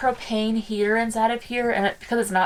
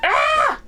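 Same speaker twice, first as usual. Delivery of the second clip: cold shriek; 4 dB louder than usual. Real, not acted.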